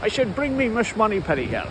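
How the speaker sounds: slurred-s Scottish impression; put-on Scottish accent; Sean Connery. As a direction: do a Scottish Bond. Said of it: "he did a Scottish accent".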